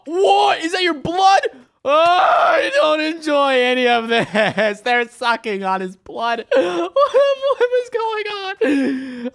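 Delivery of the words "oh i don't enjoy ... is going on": slow, high laugh-sob whine, half laughing; hammed-up wail of disgust; mock crying. Watching bugs suck worm blood, mock crying through laughter. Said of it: "I'm about to cry".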